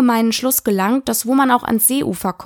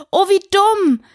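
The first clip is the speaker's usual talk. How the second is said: higher-pitched, rueful self-reproach; 10 semitones higher, 3 dB louder than usual. A narrator's reading. Alice realising she has blundered, rueful. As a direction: catch yourself with a regretful "oh". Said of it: "sagte Alice in reumütigem Tone".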